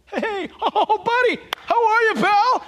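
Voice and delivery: put-on high, loud, hearty drunk greeting; jovial drunk. Drunk greeting his buddy, loud and jovial. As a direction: greet a pal with boozy over-friendliness. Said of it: "they get very jovial".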